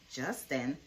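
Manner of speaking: plain reading voice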